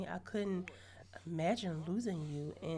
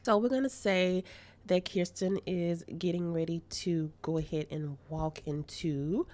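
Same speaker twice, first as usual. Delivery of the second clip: loud, slow hyped-up vlog narration; 8 dB louder, slower than usual. Real, not acted. Player hyping the viewers up for the next surprise, loudly. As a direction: announce it loudly, building hype.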